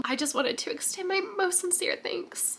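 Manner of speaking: high, soft choked-up thanks; teary thanks. Real, not acted. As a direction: thank them through tears. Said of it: "Being so dramatic for no reason".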